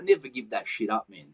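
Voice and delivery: ordinary delivery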